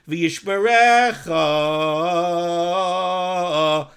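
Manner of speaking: V'yishmerecha with the final cha drawn out very long and slow; stretched last syllable. Shown as a demonstration. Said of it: "he's stretching out the last syllable of cha".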